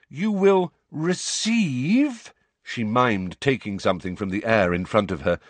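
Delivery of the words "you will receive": drawn-out, louder and higher; loud, slow voice. A narrator's reading. Umbridge talking down to Hagrid, loud and slow. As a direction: speak slowly as if to a child. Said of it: "that loud, slow voice".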